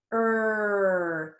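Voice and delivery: "Er" held long as the sound of R; letter sound. Shown as a demonstration. Teacher models R for children, "Er" held long. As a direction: draw out "Er" as one sustained sound. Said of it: "What sound does this letter say".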